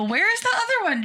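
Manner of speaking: high, quick mock-baffled question; replayed puzzled squeak; retold bewilderment. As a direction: ask it high and fast, acting lost. Acted bewildered question at drive-thru.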